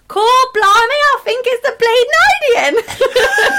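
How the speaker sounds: high, loud Cockney line; cockney accent. Retold or imitated. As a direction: belt it out like a cockney girl. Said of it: "My first line was".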